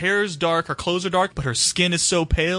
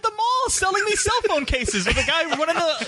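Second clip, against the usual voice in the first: high, amused voice mocking a mall phone-case seller; mall kiosk guy mockery; 12 semitones higher than usual. Podcaster mocking a movie character as a mall vendor, high and amused. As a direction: pitch it up and sneer at the kiosk guy. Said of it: "I was like".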